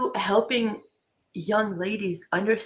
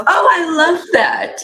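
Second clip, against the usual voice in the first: emotional delight; 8 semitones higher, 11 dB louder than usual. Real, not acted. Host moved by the technique.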